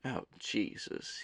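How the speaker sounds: very soft, slow, weary aside; inner thought. A narrator's reading. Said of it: "he thought".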